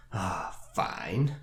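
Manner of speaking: grudging acceptance, low tone on "fine"; reluctant sigh into a growled "fine"; discontented growl. Shown as a demonstration. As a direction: say it as if forced to accept something unwanted. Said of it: "That growled low tone on the fine".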